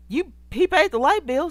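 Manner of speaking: loud, high exasperated retort. Real, not acted. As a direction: fire back in disbelief. Mocking Monique's complaint.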